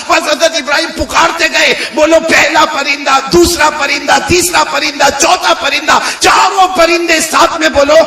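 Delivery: high, insistent preacher's count; rally the congregation with each phrase; full-force preaching. A narrator's reading. Preacher has the crowd count the birds, rousing full-force preaching.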